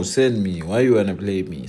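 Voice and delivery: ordinary talk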